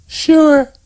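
high female voice, resigned. A narrator's reading. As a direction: sigh it out, giving in. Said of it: "said Sandra with resignation".

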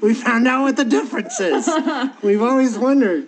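high, loud, through laughter; laughing. Real, not acted. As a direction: say it while cracking up. Joke sets both laughing.

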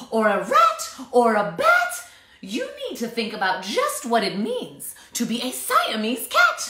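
loud, high nagging mom; lecturing mother. A narrator's reading. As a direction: nag him about being a cat. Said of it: "she added".

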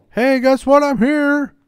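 high, loud mocking taunt; taunting sing-song. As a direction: tease the hunter in a shrill voice. Taunting voice to the intruder.

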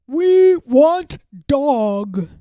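slow, booming troll roar; a giant's demanding roar; bellowing trolls. Acted. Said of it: "they bellowed".

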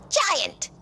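high, loud startled cry; surprised gasp. Acted. Fairy gasps at the big giant, high and loud. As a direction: gasp his name in shock. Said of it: "she gasped".

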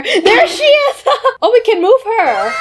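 high delighted squeal with a laugh; excited squeal. Real, not acted. Kid playing with character app.

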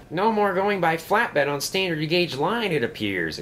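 high-pitched exclamation, settling at the end; animated storybook exclamation; exclaiming. A narrator's reading. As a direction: exclaim it high, then drop for the aside. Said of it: "exclaimed Smudger".

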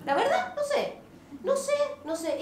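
high, laughing helpless voice; flustered helpless. Acted. New mother who can't explain the crying, high and laughing. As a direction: throw up your hands, squeaky.

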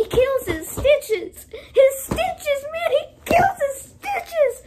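high, slow, loud squealing; hammed-up shrieking despair; mock hysterical wail. Hams up his own verbal stumble.

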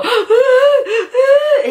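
sharp high gasp, half laugh half cry; emotional gasp. Real, not acted. Fan watching Kiefer bawl, emotional high gasp. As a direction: catch the breath loud, caught between laughing and crying.

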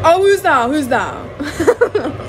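excited. Real, not acted. Greeting a pet excitedly.

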